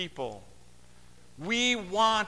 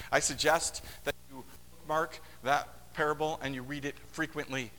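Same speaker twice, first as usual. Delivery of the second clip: ordinary delivery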